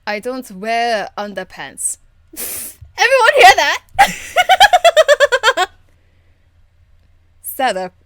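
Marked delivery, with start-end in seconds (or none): 2.3-3.8 loud, high, slow, through laughter; gleefully broadcast the secret, laughing; laughing announcement. Real, not acted. Gleeful at underpants confession. 3.9-5.7 loud high shrieking laughter; hooting cackle, very high and loud; howling laugh. Real, not acted.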